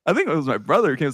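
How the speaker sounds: usual speaking voice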